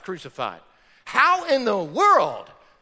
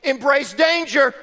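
mock mom line; 5 semitones higher, 7 dB louder, slower than usual. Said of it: "your mom probably didn't say".

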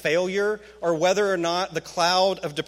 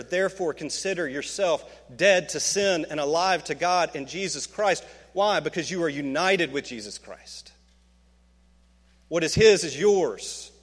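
usual speaking voice

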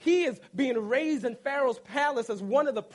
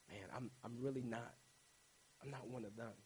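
much softer, lower, almost to himself; hushed lower dismay; stunned realization; 10 semitones lower, 19 dB quieter, faster than usual. Retold or imitated. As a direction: mutter it in shock to yourself. Moses realizes what he did.

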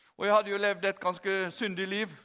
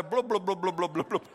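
string of blubs, sinking lower and softer; bubbling imitation; 5 semitones lower, 3 dB quieter than usual. Preacher mimics Peter drowning mid-prayer, descending bubbling sound. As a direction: glug away downwards, ending in a laugh. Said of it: "så hørte du bare".

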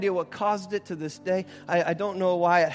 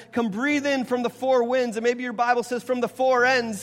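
higher-pitched scripture proclamation; impassioned preaching; 7 semitones higher, 4 dB louder than usual. Real, not acted. Pastor proclaiming scripture to the church, impassioned preaching. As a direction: build to a ringing peak, then ease at the close.

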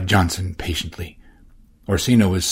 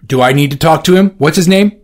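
fast, loud, high demand; fuming; 7 semitones higher, 8 dB louder, faster than usual. Acted. Orsino fuming at Johnson. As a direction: fire the questions off. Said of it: "Orsino was fuming".

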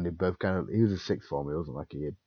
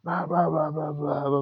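much louder, higher yell at a pupil walking off; angry shout; 11 semitones higher, 6 dB louder than usual. Retold or imitated. Teacher yelling at a pupil walking away, loud angry shout. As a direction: bellow after someone walking away. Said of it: "you can say".

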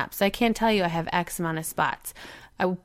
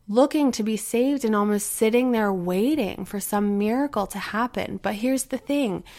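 ordinary delivery

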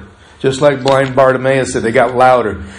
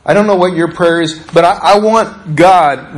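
ordinary talk

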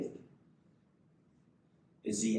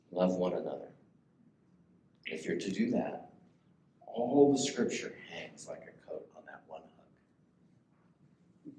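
soft closing; 8 semitones lower, 5 dB quieter than usual. Real, not acted. Preacher winds down the sermon softly before prayer. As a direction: bring it down gently for the close.

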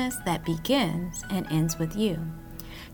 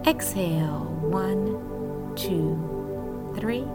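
soft, hushed counting; guided breathing count; 4 semitones lower, 6 dB quieter, slower than usual. Acted.

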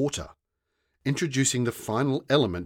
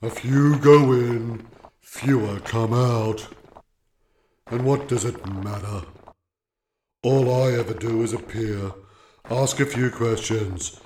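gravelly voice; 6 dB louder than usual. A narrator's reading. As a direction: drag it out, bored rock. Warden continues.

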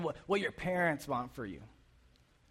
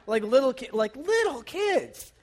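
squeaky exclamation; 7 semitones higher, 5 dB louder than usual. Real, not acted. Exclaiming about little kids.

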